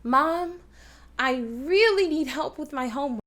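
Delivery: exaggerated accent on "really"; stress on REALLY. Shown as a demonstration. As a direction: hit "really" hard. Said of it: "what words you think I'm really accenting".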